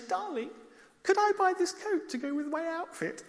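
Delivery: high, coaxing darling voice; wife sweet-talking husband into buying the coat. Retold or imitated. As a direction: coax him, pitched well up.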